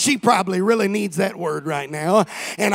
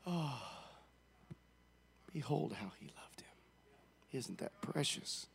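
mock sentimental whisper; 6 semitones lower, 23 dB quieter than usual. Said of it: "and they say".

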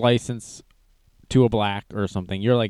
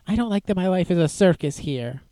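much higher, a timid pleading put-on voice; 9 semitones higher than usual. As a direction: imitate the meek sidekick asking nervously. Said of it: "he says".

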